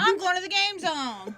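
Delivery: goofy voice. Pretend. Joins the mock bit.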